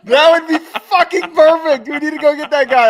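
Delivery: high, loud, thrilled outburst; excited. Real, not acted. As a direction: burst out with delight. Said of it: "excited about Psycho Mike".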